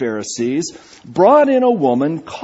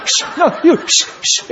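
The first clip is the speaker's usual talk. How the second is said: mock shushing spat; 13 semitones higher than usual. Mock shushing back and forth.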